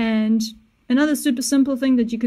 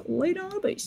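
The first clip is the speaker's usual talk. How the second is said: high voice demo; 8 semitones higher, 8 dB quieter than usual. Shown as a demonstration. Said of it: "So if I have a high voice".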